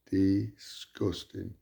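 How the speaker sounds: usual speaking voice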